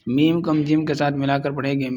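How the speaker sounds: normal talking voice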